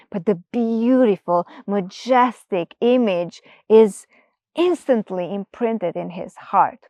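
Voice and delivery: ordinary delivery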